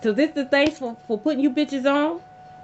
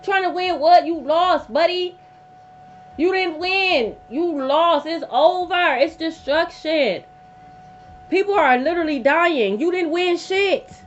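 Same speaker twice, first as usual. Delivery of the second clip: indignant rant; 4 semitones higher than usual. Real, not acted. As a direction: hammer home that nobody won. Ranting at competitive people.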